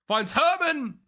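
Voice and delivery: high, loud call of the pass; excited commentary. Real, not acted. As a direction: voice leaps up as the chance opens. Calling a chance in game.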